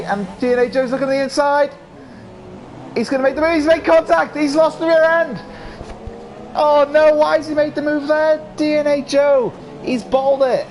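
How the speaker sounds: real shock at the crash, voice climbing; excited race call; excited commentary. Real, not acted. Crash during live race commentary.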